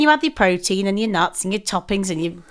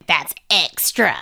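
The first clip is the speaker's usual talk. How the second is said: American server; 6 semitones lower, slower than usual. Retold or imitated. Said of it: "She went".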